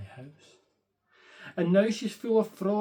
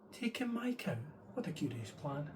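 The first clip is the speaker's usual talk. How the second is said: puzzled question; 9 dB quieter than usual. Acted. Old lady puzzled by the wise man's odd advice. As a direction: ask it in disbelief.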